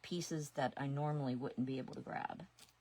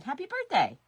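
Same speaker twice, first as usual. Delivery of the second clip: higher, louder chirpy greeting; cheery birthday wish; 10 semitones higher, 13 dB louder than usual. Retold or imitated. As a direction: chirp it brightly to your husband. Retelling wishing husband happy birthday.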